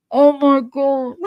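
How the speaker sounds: high, loud, drawn-out exclamation; retold exasperated outcry; hammed-up dismay. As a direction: stretch it out in mock horror. Retelling her exasperated reaction to neighbor.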